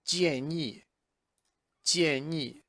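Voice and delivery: "建议" said clearly with its tones; model word. Shown as a demonstration. Teacher introduces a new word, carefully. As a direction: present "建议" carefully. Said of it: "Next word is".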